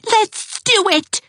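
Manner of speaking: high, slow gleeful squeal. Acted. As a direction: squeal with glee. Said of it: "squealed Geraldine".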